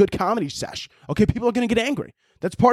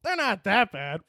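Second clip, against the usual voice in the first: mock Twitter CEO; 9 semitones higher, slower than usual. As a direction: play it down like a shrugging exec. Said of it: "Twitter goes".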